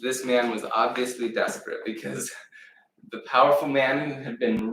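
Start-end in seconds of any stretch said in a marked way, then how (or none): none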